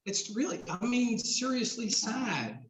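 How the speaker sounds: real choked-up voice, high and strained with feeling. Real, not acted. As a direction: fight back tears while insisting on it. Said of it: "I get choked up".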